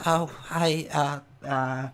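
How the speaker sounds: put-on old woman unsure what to say; shaking old lady. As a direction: falter through a timid greeting. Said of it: "she's shaking a bit".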